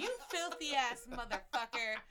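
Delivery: slow, loud laughing jab; laughing insult. Real, not acted. Roasting a friend over his recommended videos, laughing loudly.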